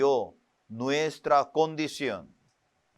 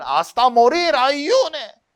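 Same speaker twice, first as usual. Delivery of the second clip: higher, louder order from the pastor; stern pastor; 10 semitones higher, 6 dB louder, slower than usual. Retold or imitated. Preacher imitating his old pastor ordering more fasting, stern. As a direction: bark it like a demanding pastor.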